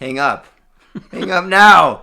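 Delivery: loud, high playful shout; giggly late night call. Real, not acted. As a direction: yell it out teasingly. Playful who-hangs-up-first game.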